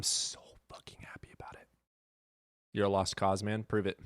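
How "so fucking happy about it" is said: whispering. Real, not acted. Excited aside about microwave.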